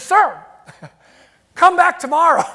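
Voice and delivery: high, loud imitation of a woman calling after someone. Store clerk calls to a customer walking out, loud high holler. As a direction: holler it as the excited clerk, hammed up.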